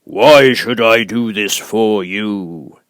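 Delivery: great and terrible Oz. Acted. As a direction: loom over her, slow and thundering. Oz asks.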